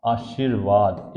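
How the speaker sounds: आशीर्वाद drawn out slowly, every syllable clear; slow clear pronunciation. Shown as a demonstration. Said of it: "क्या नहीं लिख पाते हैं?". (आशीर्वाद धीरे, साफ़ उच्चारण)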